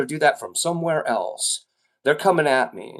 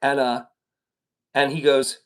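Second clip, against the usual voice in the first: ordinary delivery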